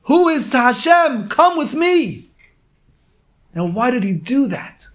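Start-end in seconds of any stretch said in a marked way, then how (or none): 0.0-2.3 high, loud rallying cry; zealous cry. Retold or imitated. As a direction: call them to arms at full voice. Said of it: "and he screamed".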